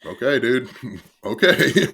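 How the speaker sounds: loud, high, laughing humoring; amused disbelief. Retold or imitated. Reacting to a partner's bold promise, laughing disbelief. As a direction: humor him loudly, laughing through the doubt. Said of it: "That's how I felt".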